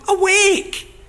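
loud, high, desperate cry to wake God; shouting at God. Acted. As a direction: shout it up at heaven, demanding. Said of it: "he says to God".

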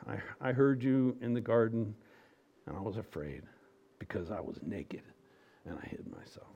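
fading to a frightened whisper; guilty man dropping into a whisper; fearful Adam. Acted. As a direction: confess it, shrinking away to nothing. Adam answers God afraid.

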